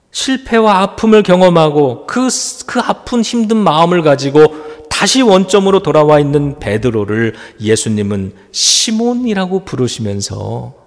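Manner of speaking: normal talking voice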